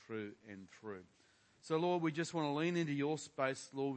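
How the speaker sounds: normal talking voice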